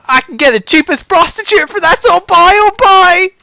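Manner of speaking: put-on high, loud, eager little boy; excited boy voice. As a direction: squeal it like a thrilled kid. Monk boy again.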